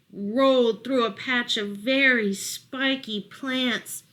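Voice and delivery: ordinary delivery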